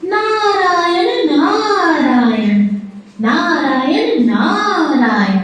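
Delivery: slow, lilting singsong call of the divine name; Narad Muni. Acted. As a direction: stretch each word in long gliding swoops. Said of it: "वहां नारद मुनि प्रकट हुए". (लय में खिंचा हुआ जाप)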